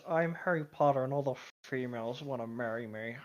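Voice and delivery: ordinary delivery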